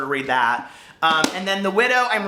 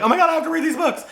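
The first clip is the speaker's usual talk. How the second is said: high, fast fake panic; much higher, rushed mock stress; 10 semitones higher, 3 dB louder, faster than usual. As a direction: squeal it like a frantic reader. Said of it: "I'm not gonna be like".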